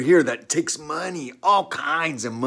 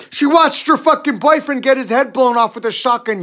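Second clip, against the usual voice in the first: much higher and louder, outraged; furious shout; 8 semitones higher, 4 dB louder than usual. Real, not acted. Accusing the church member of cruelty, outraged shout. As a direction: hurl the accusation at him.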